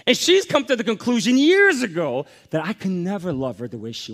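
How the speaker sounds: loud, rising pulpit preaching; fervent sermon climax; preaching at full force. Acted. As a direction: drive it home to the congregation. Confessing about his wife loudly.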